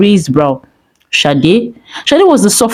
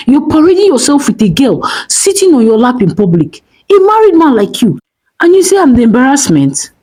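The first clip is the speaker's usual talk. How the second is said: angry; 7 semitones higher than usual. Acted. Wife confronting cheating husband.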